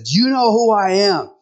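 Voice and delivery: lightly voiced menacing devil; menacing Satan. Satan in the joke demanding if the old man knows him, menacing.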